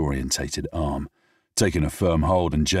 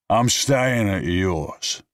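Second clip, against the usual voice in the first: put-on drunken stubbornness; 5 semitones higher, 4 dB louder, much slower than usual.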